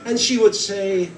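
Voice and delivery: ordinary delivery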